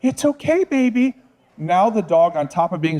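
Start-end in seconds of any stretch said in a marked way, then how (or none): 0.0-1.1 put-on high anxious it's okay baby; fretful baby talk. Shown as a demonstration. Trainer shows owner fussing over frightened dog, high and anxious. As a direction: coddle the scared dog, voice up high. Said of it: "if you focus on that event".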